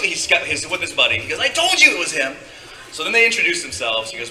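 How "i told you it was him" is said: high, loud retold crowing; excited triumph. Excited kid proving it to his buddy, high triumphant voice. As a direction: crow it high and thrilled.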